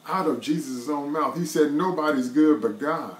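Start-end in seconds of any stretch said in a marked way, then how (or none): none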